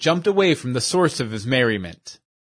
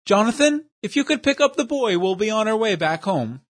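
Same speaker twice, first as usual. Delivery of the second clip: higher, fussy, overly formal voice; pompous gentleman; 8 semitones higher than usual. Acted. Portly schemer praises his dim companion, high and prissy. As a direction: play a prissy know-it-all praising his henchman.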